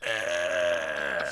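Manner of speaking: long, low droning "ah"; imitated baby noise. Father imitating his baby daughter, low drone. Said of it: "sitting over there on Daddy's lap going".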